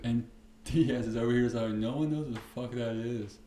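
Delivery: ordinary delivery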